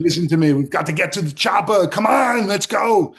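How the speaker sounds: loud action-hero impression with accent; hammed-up shouted movie-hero imitation; Arnold Schwarzenegger. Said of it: "imitate Arnold".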